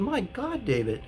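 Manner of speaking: high, alarmed cry of horror; alarmed therapist. Retold or imitated. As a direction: sound shocked and frightened for him. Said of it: "She said".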